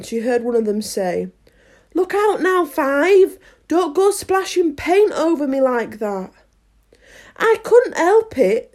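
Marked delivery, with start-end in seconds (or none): 1.9-6.3 raised, higher-pitched annoyed warning; scolding gardener. Acted. Gardener scolds Five for splashing paint. 7.4-8.7 high, loud sulky protest. A narrator's reading. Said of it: "said Five in a sulky tone".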